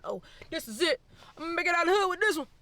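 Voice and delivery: loud, high, rushed hopeful outburst; mock over-excitement. Filmmaker putting pressure on one film, mock-excited. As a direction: play the filmmaker sure this is the big break.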